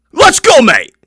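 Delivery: high, loud mock British accent; hammed-up British hype. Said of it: "do this video in a British accent".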